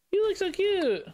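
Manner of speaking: high cooing praise. Real, not acted. Talking to the baby.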